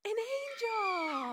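slow, high, far-off angel cry; angel reveal. Acted. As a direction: call out like a heavenly voice. Angel appears with scream.

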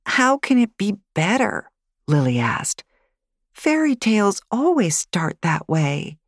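put-on high child voice, questioning; a small girl protesting the rules of stories; doubtful little girl. Girl doubts grandmother's story.

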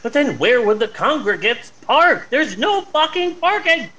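high-pitched indignant protest; exasperated outburst. Acted. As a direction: protest the parking problem in rising exasperation. Mock outrage over missing parking.

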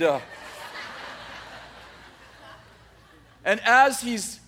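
ordinary delivery, nothing marked

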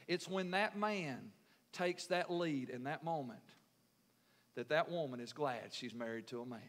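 usual speaking voice

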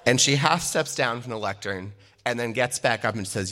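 usual speaking voice

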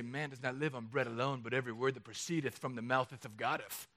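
low, solemn mock King James recitation; mock pious scripture-quoter. Preacher parodies a pious reply to a hungry friend, mock pious. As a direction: intone it like an over-holy verse-quoter.

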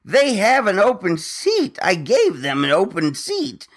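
indignant outburst. Real, not acted. Indignant rant about airline fees.

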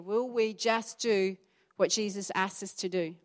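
ordinary delivery

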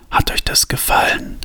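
put-on raspy, whispered old woman; hoarse whisper. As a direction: rasp it out softly. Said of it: "sagte sie mit heiserer Stimme". (heiseres Flüstern)

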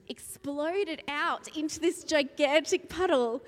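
normal talking voice

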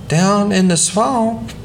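put-on high, eager little boy; a proud little boy pipes up; small boy voice. A narrator's reading. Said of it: "declared Doodle".

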